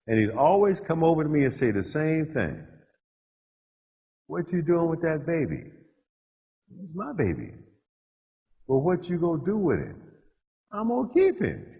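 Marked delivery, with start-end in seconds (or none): 4.3-5.7 a hint of a curious old pastor, higher and softer; lightly voiced reverend. Reverend asking the young father about the baby. Reverend questions him in food line. 6.8-11.7 softer, higher, firm on my; a touch of proud young father. Retold or imitated. Young father answering the Reverend, proud and firm.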